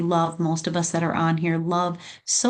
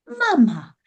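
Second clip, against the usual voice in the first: put-on high, louder little child's call of mama; baby calling mama; 11 semitones higher, 4 dB louder than usual. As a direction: call out like a tiny baby saying its first word.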